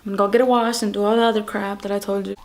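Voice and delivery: ordinary talk, nothing marked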